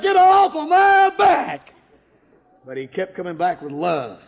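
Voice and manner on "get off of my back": high, loud shouted refusal; preacher's testimony, yelling him off; retold shout. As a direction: fling it back at him.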